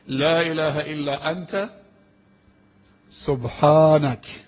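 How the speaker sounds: slow, drawn-out chanted recitation; Quranic recitation. Acted. Preacher recites the prophet's prayer to the congregation, slow and chanted. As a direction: stretch each word as in prayer.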